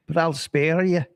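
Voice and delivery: ordinary talk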